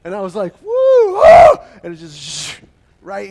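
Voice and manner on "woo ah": loud, high whoop into a scream; whoop then scream. Retold or imitated. Boy on a tree branch, whooping then screaming.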